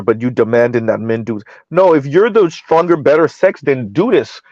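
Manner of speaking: usual speaking voice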